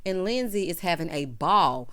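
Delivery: ordinary talk, nothing marked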